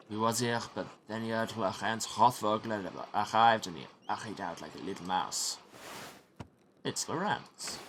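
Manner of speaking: scornful French monk; French accent. Acted. Brother Guy belittling the absent prior, French accent. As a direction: sneer about a colleague in a French accent.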